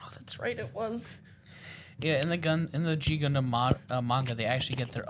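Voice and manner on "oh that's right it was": soft, breathy whisper; whispered realisation. Real, not acted. Remembering the pilot's name, whispered. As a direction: half-whisper the memory. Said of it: "sudden recollection".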